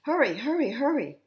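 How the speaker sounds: high, loud urgent call; rally the firemen to rush; excited cry of hurry. Acted. Firemen rushing to a fire.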